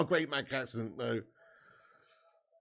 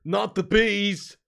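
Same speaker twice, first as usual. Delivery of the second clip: loud, drawn-out mock cry; 3 semitones higher, 8 dB louder, much slower than usual. Streamer quoting a film line, loud slow mock cry. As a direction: stretch the words out, louder. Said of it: "Do Nicolas Cage".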